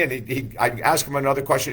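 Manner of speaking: ordinary delivery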